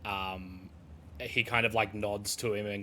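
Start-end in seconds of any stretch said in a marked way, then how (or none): none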